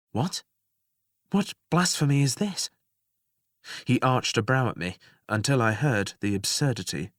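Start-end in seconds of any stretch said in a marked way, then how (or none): 0.1-2.7 scandalized, as if hearing heresy; shocked outrage. A narrator's reading. The woman, stunned by his words, crying out in disbelief. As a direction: voice jumps high in disbelief.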